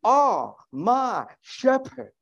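slow, drawn-out, high proclaiming; preaching. Acted. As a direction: sing out the psalm line slowly. Opening psalm line, drawn out.

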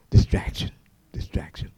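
hushed, voiceless whisper. Real, not acted. Preacher to congregation after a texting crash example, whispered. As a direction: whisper it as an ominous echo.

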